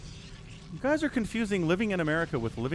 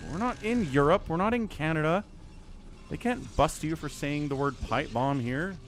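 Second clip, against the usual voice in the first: ordinary talk, nothing marked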